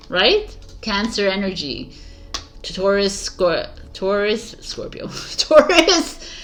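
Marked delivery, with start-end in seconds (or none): 4.6-6.3 laughing over a slip of the tongue; real laughing. Real, not acted. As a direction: break into a high laugh between the words. Laughs while tripping over sign names.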